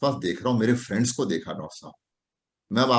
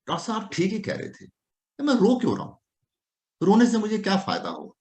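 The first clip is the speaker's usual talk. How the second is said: patient's realization; 8 semitones higher than usual. Acted. Doctor voices patient's realization.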